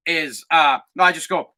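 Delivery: ordinary talk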